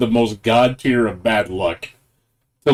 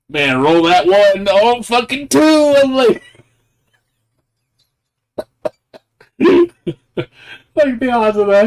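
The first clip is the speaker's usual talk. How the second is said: higher, louder, slow, worked-up replay; excited disbelief; 12 semitones higher, 6 dB louder, slower than usual. Retold or imitated. Replaying the winning roll to viewers, excited disbelief. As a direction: relive the roll with howling disbelief.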